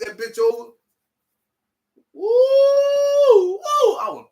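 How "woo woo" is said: very high, loud whoops; excited whoop. Retold or imitated. Man flips the bill over and whoops very high. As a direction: let out joyful hollers.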